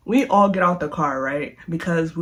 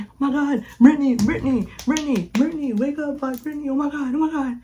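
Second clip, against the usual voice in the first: higher, panicked calling of her name; 8 semitones higher, faster than usual. Retold or imitated. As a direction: plead for her to wake up. Friends panicking over fallen Brittany.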